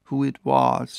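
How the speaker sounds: plain reading voice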